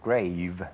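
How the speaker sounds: "grave" drawn out to a voiced V; long voiced ending. Shown as a demonstration. Teacher gives examples of the rule, long voiced ending. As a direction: stretch "grave" into a buzzing V. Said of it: "ต้องลากเสียงยาว".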